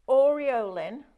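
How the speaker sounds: "Aureolin" said like Oreo with Lin on the end; "Aureolin" in careful, separate syllables, OR-ee-oh-lin; careful pronunciation. Shown as a demonstration. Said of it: "We've just worked out how to say it".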